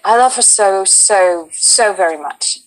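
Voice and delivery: put-on chatty anecdote told in a British accent. Telling the host her audition story in a British accent. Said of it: "you did this whole story in that British accent".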